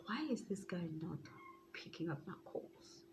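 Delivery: unvoiced whispered worry to herself; inner thought whispered. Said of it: "deep inside, I was like".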